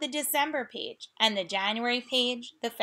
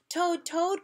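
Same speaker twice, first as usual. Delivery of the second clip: higher, rising call of the name; crying out; 8 semitones higher than usual. Acted. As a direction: call out eagerly. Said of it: "cried Frog".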